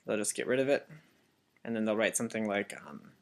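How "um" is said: usual speaking voice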